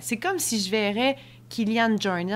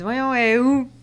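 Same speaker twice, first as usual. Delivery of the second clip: calling out; 6 semitones higher, 9 dB louder than usual. Retold or imitated. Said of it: "Il me dit".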